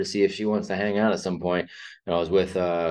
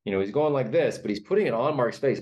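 ordinary delivery

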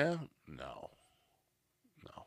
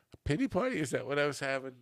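much higher, incredulous repeat; surprised echo; 8 semitones higher, faster than usual. Real, not acted. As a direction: toss the phrase back in disbelief. Reacts to chat's pity party.

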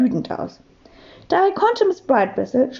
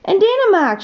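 hoher Ausruf eines Schülers; gerufen; 8 semitones higher, 3 dB louder than usual. A narrator's reading. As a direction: die Antwort hoch herausrufen. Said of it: "schrie Hugo". (leicht gedämpfter Kinderschrei)

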